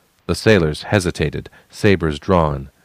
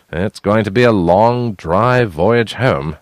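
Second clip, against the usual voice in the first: deadpan; 3 semitones higher, 4 dB louder, slower than usual. A narrator's reading. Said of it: "Asnai deadpanned".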